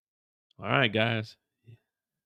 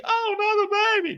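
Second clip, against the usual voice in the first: high falsetto lament; falsetto wail. Acted. Mother crying over another baby, high falsetto wail. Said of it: "And he".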